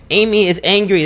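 mock angry announcement. Real, not acted. Joking new name.

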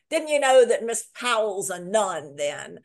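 put-on young man teasing a girl loudly in front of the pub; calling out to the room. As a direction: announce it to everyone, teasing. [name] calls out to the whole pub.

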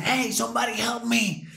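high pleading English cry for help; imitating desperate friend. Friend calling out over the fallen man, high pleading. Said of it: "y lo azota y dice".

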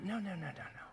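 soft, rapid retold protest; dismayed muttering. Youth seeing a disliked classmate walk into church, dismayed muttering. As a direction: mutter it under your breath in mock dread. Retells dread at seeing Sam arrive.

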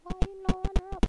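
lightly high, fast mocking whine; mock broken-English complaint. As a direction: tease them in a silly voice. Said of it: "why you no wait".

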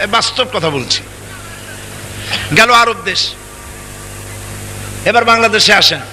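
normal talking voice

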